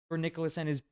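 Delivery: usual speaking voice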